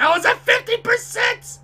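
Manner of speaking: very high, loud incredulous yell; outraged disbelief. Real, not acted. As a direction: shriek in protest at the unfairness. Outraged at game progress.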